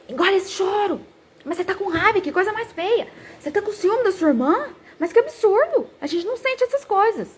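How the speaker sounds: high, mock-stern mother telling off a child; mock scolding mum voice; scolding parent. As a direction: imitate a parent shaming the child's tears.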